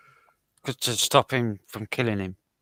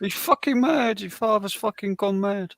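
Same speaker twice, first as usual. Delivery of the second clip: frantic mum; 9 semitones higher than usual. Retold or imitated. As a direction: keep up the frightened mum's cry. Still voicing the panicked mum.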